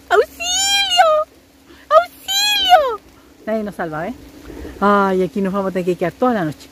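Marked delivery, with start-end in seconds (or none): 0.1-1.1 very high playful call of auxilio; mock cry for help. Stuck in the rain, joking to viewers, very high mock call for help. 1.9-3.1 auxilio stretched very slow and very high; drawn-out mock cry for help. Stuck in the rain, hamming it up to viewers with a long high wail.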